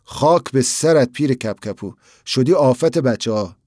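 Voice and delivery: ordinary delivery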